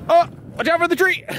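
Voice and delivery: high fast panicked warning; alarmed warning. Real, not acted. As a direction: blurt it as the tree looms. Steering wagon toward tree.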